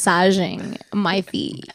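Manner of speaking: normal talking voice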